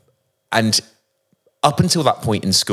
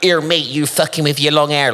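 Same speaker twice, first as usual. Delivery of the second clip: put-on higher, rough Bristol accent jeer; mock Bristol bully; 7 semitones higher, 3 dB louder than usual. Imitating bullies mocking his long hair, Bristol accent jeer. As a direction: sneer it like a West Country schoolyard bully. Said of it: "people were to me like".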